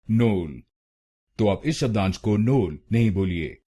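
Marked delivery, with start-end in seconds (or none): 0.1-0.6 नोल with a closed o, nol; wrong syllable. Shown as a demonstration. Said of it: "गलत pronunciation में".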